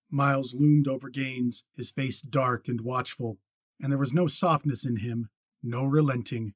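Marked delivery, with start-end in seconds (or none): none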